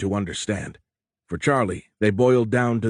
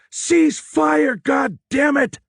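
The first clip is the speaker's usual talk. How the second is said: screaming order, much higher and louder, slowing at the curse; furious shout; 5 dB louder, much slower than usual. Acted. Charlie running on deck to halt the firing, furious shout. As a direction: yell it with everything you have. Officer stopping a massacre.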